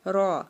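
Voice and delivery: را full-mouthed and rolled; heavy raa. Shown as a demonstration. Teacher models heavy را. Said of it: "را کو ہمیشہ پر پڑھا جاتا ہے".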